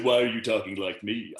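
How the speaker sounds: comic impersonation of an admiral, emphatic; George Takei as Sulu. Acted. Sulu challenges the actor copying him, impersonated. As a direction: question it in a put-on, measured voice. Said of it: "but me doing Sulu's voice".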